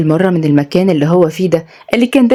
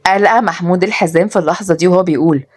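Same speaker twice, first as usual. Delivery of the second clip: ordinary delivery, nothing marked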